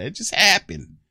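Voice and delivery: high-pitched feigned helplessness; mock innocent excuse. Caught taking all the cookies, high mock-innocent whine. Acts child's excuse for mistakes.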